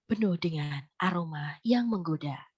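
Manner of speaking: low and tempting, like a luxury ad; low tone ad read. Shown as a demonstration. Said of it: "itu namanya low tone". (nada rendah menggoda)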